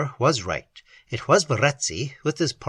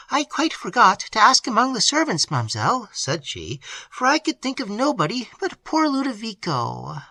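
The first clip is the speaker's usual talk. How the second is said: put-on higher, apologetic young servant; maid's voice; 8 semitones higher than usual. A narrator's reading. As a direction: excuse yourself, thinking of him. Annette, maid voiced by reader.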